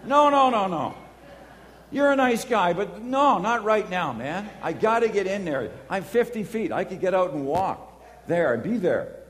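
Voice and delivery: high, loud pleading no, no, no; yelling at the driver letting cars out; frantic protest. Retold or imitated. Late for class, shouting at a too-polite driver, loud and frantic. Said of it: "I'm saying".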